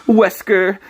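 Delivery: slow, high taunting villain; Bass impression. Taunting Wesker as Bass, slow and high. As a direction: sneer down at Wesker. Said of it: "trying to do base dot E X E".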